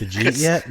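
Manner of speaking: "Did you eat yet" squashed into "jeet yet"; Jersey slurred pronunciation. Shown as a demonstration. Jersey native demonstrating the local question, slurred jeet-yet. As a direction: swallow "did you" into a single j. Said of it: "D apostrophe J E E T".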